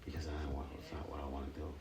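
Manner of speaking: low, hushed, near-whispered confession; soft and low, confiding real remorse; quietly owning up to hurt; subdued regret. Real, not acted. Regretting having yelled at his children.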